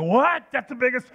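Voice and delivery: incredulous yelp at the guide; disbelief. Retold or imitated. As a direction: protest in shock at letting it go. Shocked at releasing fish.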